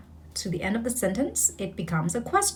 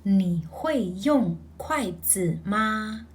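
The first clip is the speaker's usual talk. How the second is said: slow, careful full sentence; slow Mandarin practice line; slow repeat; much slower than usual. Shown as a demonstration.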